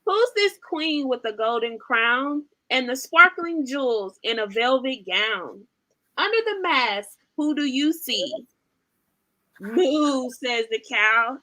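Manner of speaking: lively, sing-song storybook reading; animated picture-book read-aloud; reading to children. Acted. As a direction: read it like bedtime for toddlers.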